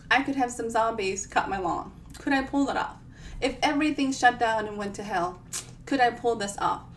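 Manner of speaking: usual speaking voice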